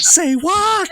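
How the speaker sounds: loud, high-pitched incredulous squeal; mock disbelief. Game master teasing a player mid-joke, mock disbelief.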